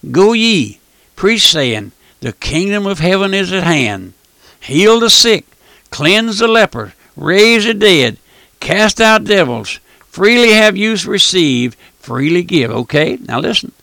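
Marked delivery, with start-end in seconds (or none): 0.1-11.8 loud, slow and emphatic; proclaimed scripture. Acted. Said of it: "Listen what the Lord says". 12.0-12.7 scripture proclaimed at full force; forceful preaching of scripture. Acted. Preacher quoting the Lord forcefully.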